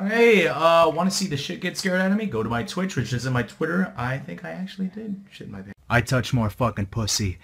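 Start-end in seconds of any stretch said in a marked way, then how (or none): none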